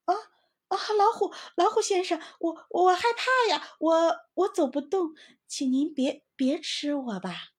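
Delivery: high, stammering mock-frightened voice; feigned fear. Squirrel fakes terror to fool the tiger, high stammering. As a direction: pretend to tremble before the tiger. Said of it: "装出很害怕的声音".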